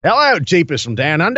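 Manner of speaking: hammed-up Australian accent. Co-host rereading a listener's review on request, mock Australian accent. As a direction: put on a broad mock-Aussie voice. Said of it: "read this in an Australian accent".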